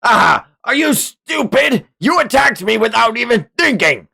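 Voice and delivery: high, loud scornful jeer; mocking villain. Streamer voicing the villain scolding Julian, scornful shout. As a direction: jeer at his reckless opponent.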